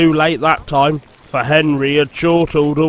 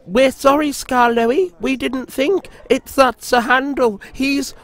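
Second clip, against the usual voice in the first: whiny coaches; 10 semitones higher than usual. Acted. Coaches excusing themselves.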